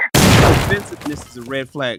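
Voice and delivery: loud emphatic repeat. Shown as a demonstration. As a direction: hammer the line home loud. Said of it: "repeated louder".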